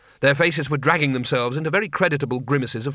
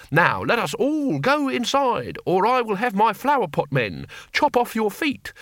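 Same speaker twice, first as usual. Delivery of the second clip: playing emperor; 8 semitones higher than usual. A narrator's reading. Cohen plays emperor, threatening guards.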